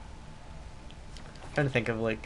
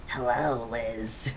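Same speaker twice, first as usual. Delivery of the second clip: Cell voice; 4 dB quieter, much slower than usual. Retold or imitated. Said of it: "imperfect Cell from Dragon Ball Z".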